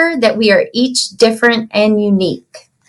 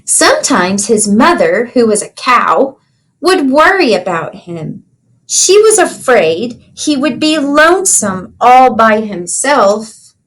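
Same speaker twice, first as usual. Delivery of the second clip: storybook reading. Acted. Teacher reads picture book aloud.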